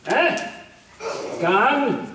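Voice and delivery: ordinary talk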